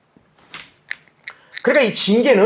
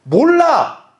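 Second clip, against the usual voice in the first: high, loud, defiant shout; stubborn animal; 9 semitones higher, 6 dB louder, much slower than usual. Retold or imitated. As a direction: play the stubborn beast refusing. (고집 센 가축처럼 높고 크게 외치는 목소리)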